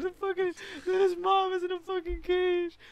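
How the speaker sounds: high, cracking mock-crying rant; teary outburst. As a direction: blurt it like you're falling apart in tears. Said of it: "I was just a mess".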